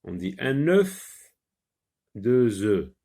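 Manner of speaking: deux œufs said as "deu-zeu", no F sounded; silent f in plural. Shown as a demonstration. Teacher showing the plural deux œufs is said without F. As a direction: link deux œufs as døz ø, dropping the F. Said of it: "on ne prononce pas, euh, F S ici".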